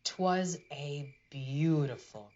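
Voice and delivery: ordinary delivery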